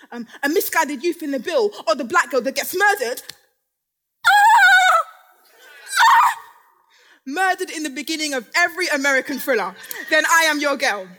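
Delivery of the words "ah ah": piercing high victim scream; horror scream. Acted.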